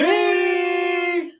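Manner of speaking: high, loud celebratory holler; excited cheer. Real, not acted. Host celebrating ten thousand subscribers, excited cheer. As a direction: belt it out and hold it.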